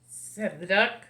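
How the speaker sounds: usual speaking voice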